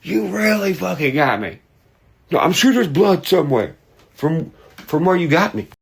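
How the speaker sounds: high-pitched fake admission of defeat; hammed-up high voice, pretending to be hit; mock wounded reply. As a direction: play sarcastically hurt, up high. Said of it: "I'm just like".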